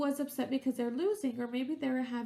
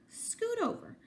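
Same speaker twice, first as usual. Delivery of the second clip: complaining; 10 semitones higher than usual. A narrator's reading. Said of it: "complained Duck".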